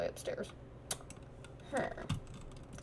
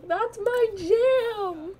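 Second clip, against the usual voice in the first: high, drawn-out cheer; excited exclamation; 11 semitones higher, 4 dB louder, much slower than usual. Pretend. Reacting to spooky music on the radio, excited exclamation. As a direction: cry out high, stretching the words.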